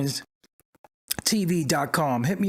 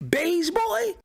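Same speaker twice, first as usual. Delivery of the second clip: much higher, disbelieving question; incredulous; 12 semitones higher than usual. Real, not acted. Streamer repeats the insult he heard, incredulous.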